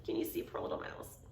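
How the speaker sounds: put-on whispered question to children; soft whisper, a little fast. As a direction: hush it like a secret.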